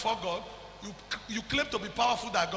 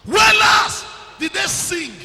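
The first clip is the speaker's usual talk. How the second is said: high, loud, slow pulpit shout; 10 semitones higher, 15 dB louder, slower than usual. Real, not acted. Preacher shouting at the congregation, slow and high. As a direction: stretch it out at full volume.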